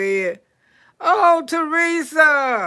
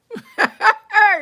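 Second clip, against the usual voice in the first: high laugh; 9 semitones higher than usual. Real, not acted. End of the knife story.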